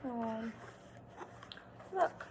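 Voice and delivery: usual speaking voice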